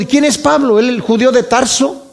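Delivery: mock the upstart from Tarso; false apostles scoffing at Paul, in a high sneer; scornful rivals. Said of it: "Tal vez estaban diciendo".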